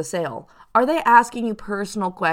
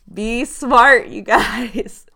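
higher, louder "be smart"; louder emphatic warning to viewers; loud urging; 4 semitones higher, 7 dB louder, much slower than usual. Real, not acted. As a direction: push the warning hard. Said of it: "urging the viewers".

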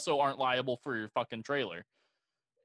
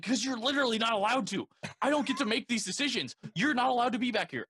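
higher, insistent voice laying down the rules; retold firm telling-off; 8 semitones higher, 3 dB louder than usual. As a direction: raise the voice up high to shut the driver down. Retold standoff with trucker.

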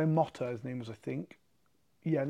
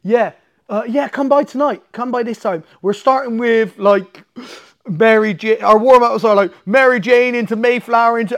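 loud, high, eager invite rattling off the warm-ups; mock the coach's breezy welcome, loud and high; hammed-up gym coach, higher and louder; retold coach invite; 5 semitones higher, 10 dB louder than usual. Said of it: "He's like, um".

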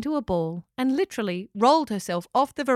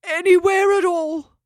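high, loud kangaroo voice; lazy, carefree exclamation; character voice; 10 semitones higher, 8 dB louder than usual. A narrator's reading. Steve voiced.

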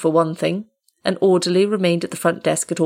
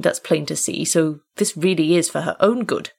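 ordinary delivery, nothing marked

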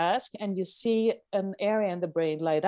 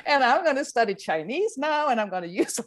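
higher-pitched, giddy mock resolve; excited; 8 semitones higher than usual. Scientist mocking the spare-brain myth, excited. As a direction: get carried away, breaking into a laugh.

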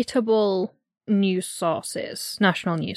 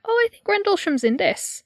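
higher voice; high surprised thought; 11 semitones higher than usual. Retold or imitated. Retold own thought.

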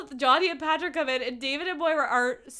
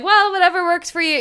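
mock casual; 6 semitones higher, 7 dB louder than usual. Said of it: "he's trying to be casual".

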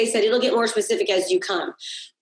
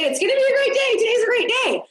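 much higher, bright-eyed morning cheer; cheery affirmation; 11 semitones higher, faster than usual. Retold or imitated. Coach mimicking the morning affirmation, excited. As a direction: sing out the good-day promise.

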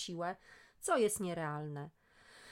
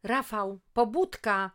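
loud, high shout to wake a sleeping son; mother shouting her son awake, loud and high; shouting wake-up call; 3 semitones higher, 10 dB louder, slower than usual. Acted. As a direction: yell him out of bed. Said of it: "krzycząc próbowałam go dobudzić".

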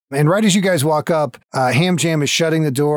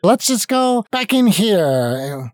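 slow, higher mock sulk; Ham Jam voice; 7 semitones higher, much slower than usual. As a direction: drag it out, pitched up. Voicing Ham Jam, mocking scene.

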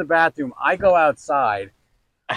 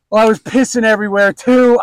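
sheepish wife confession; 8 semitones higher, 9 dB louder than usual. Retold or imitated.

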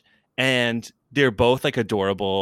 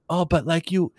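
higher-pitched, lightly imitated sweet boyfriend; a hint of a sweet Frenchman, pitched up; sweet French guy; 6 semitones higher than usual. Imitating the French half of a cute couple, sweetly apologetic. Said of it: "he's just so sweet and, like, in his French accent".

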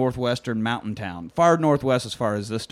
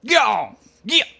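higher, louder shooing call; 12 semitones higher, 7 dB louder than usual. Retold or imitated. O B shooing a dog inside, loud high call. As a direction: shoo the dog in. Calling out, dreaming same thing.

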